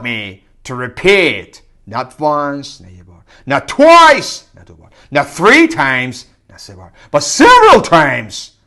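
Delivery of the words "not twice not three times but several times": emphatic. Real, not acted. Learner stressing how often to repeat, emphatic. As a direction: drive each number home.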